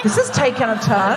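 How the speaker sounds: ordinary delivery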